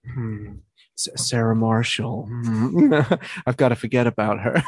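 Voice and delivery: a half-hearted wistful heartbroken guy; bad impression. Retelling the film's lookalike scene, lightly voiced brooding. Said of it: "Such a shit impression".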